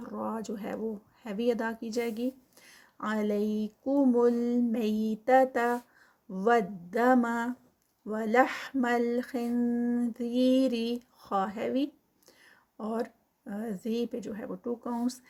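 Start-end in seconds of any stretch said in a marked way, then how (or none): 3.0-10.7 slow, louder chant; Quran recitation. Shown as a demonstration. Teacher repeating the verse for students, slow and louder. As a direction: recite each word with long vowels. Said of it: "repeats the part as a recitation".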